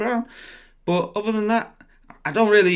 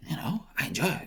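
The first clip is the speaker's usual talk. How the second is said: soft unvoiced whisper; whispered aside to viewers; 7 semitones higher, 7 dB quieter than usual. Real, not acted. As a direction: breathe it as a secret.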